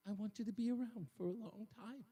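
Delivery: hushed, mock-tearful wife impression; half cry, half sigh. Imitating wife guilt-tripping her husband, half crying. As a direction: imitate her pleading softly through a sigh. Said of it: "with half of a cry and half of a sigh".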